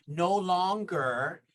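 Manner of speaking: ordinary delivery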